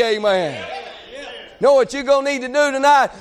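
usual speaking voice

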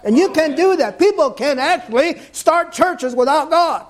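high, strained pulpit delivery; full-force preaching. Real, not acted. Preacher urging his congregation, high-pitched full-force preaching. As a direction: preach it in a high, driving cry.

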